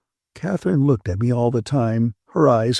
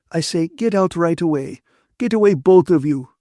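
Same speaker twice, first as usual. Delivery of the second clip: higher, indignant order to leave; angry dismissal; 7 semitones higher, 6 dB louder than usual. A narrator's reading. Ferguson orders Catherine and her lover out, angry. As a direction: drive them both away.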